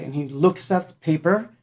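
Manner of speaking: ordinary delivery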